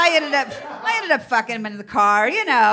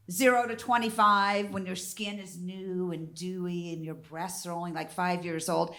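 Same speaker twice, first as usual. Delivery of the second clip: ordinary talk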